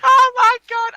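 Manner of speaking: high, loud, laughing retelling; laughing recollection. Real, not acted. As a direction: exclaim it in fits of amusement. Laughing while recalling the video.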